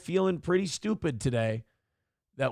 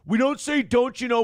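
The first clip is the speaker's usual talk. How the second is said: louder and higher, indignant protest; 8 semitones higher, 4 dB louder than usual. Real, not acted. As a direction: raise the pitch and protest hard.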